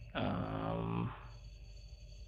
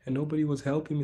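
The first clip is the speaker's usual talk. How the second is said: higher, quicker mock-whiny retelling; complaining foreigner; 6 semitones higher than usual. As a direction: imitate a frustrated expat venting. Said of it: "I've seen people tell me".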